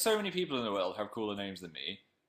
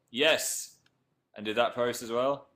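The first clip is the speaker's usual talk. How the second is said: ordinary delivery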